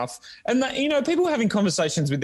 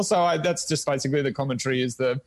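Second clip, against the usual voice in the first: usual speaking voice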